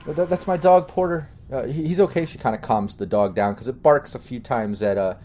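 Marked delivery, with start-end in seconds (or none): 0.1-2.2 put-on lightly higher girl's voice; a touch of relieved young woman; Penny explaining.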